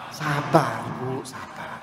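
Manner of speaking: calm a hurt wife gently; soothing. Acted. Comforting wife of remarrying husband.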